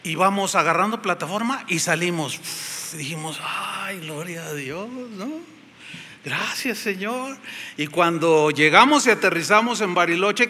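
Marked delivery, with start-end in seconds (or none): 2.4-7.4 soft, hushed thanks; relieved sigh. Retold or imitated. Traveller thanking God once the plane takes off, hushed. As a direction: exhale in relief, quietly grateful.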